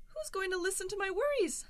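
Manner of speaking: ordinary talk, nothing marked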